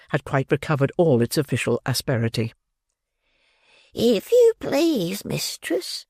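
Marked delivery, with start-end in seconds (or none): 3.9-6.0 put-on high, slow old crone voice; quavering withered old woman; old pauper woman. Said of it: "a withered old female pauper".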